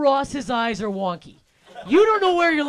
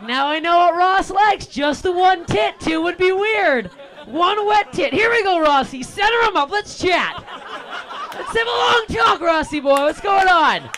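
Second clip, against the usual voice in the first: higher, louder, laughing rant; excited riff; 7 semitones higher, 6 dB louder than usual. Acted. Comic riffs to the crowd about Ross, loud and gleeful. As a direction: crank it up with glee.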